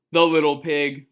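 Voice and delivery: ordinary delivery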